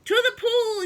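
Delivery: high, loud shout; yelled. Acted. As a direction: call it out to the crowd. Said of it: "yelled the firemen".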